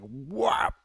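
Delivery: a sharp, loud mock whap of a punch landing; punch sound effect. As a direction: slap out a pow noise. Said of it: "Sorry, that was loud".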